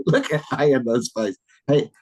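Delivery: ordinary delivery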